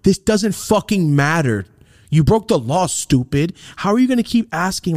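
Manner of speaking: berating a dumb friend; high exasperated scold. Retold or imitated. Scolding homie.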